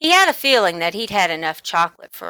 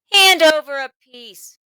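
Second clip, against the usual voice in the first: loud, higher demand; bully ordering loudly; 8 semitones higher, 8 dB louder than usual. A narrator's reading. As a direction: order him to hand it over.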